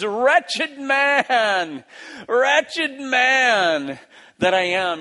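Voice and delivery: high, loud cry of self-reproach; frustrated cry. Acted. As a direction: wail it out, fed up with yourself. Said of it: "he just yells out".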